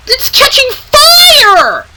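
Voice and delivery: alarmed shout. Acted. Engines see fire, shouting.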